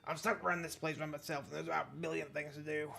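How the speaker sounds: lightly voiced overworked park keeper, apologetic; put-on voice of a swamped park worker; harried groundskeeper. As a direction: apologize as a busy groundskeeper brushing someone off.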